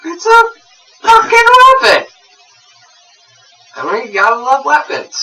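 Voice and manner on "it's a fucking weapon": high, loud mock outburst; hammed-up outburst. Co-host defending his anthrax threats, hammed-up high outburst. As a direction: blurt it out far above your usual pitch.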